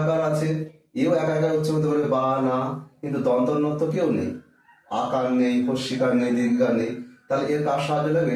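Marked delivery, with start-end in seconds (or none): none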